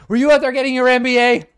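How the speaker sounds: loud, high accusation; mock outrage. A dad loudly accuses his daughter of getting an MBA, in mock outrage. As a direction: blurt it out in mock horror.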